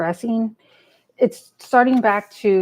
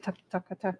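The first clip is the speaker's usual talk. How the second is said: much softer, mumbled under the breath; mumbling; 11 dB quieter than usual. Real, not acted. Asking the cards under her breath, quiet mumble. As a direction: mutter it so the viewers barely catch it. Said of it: "I usually try mumbling".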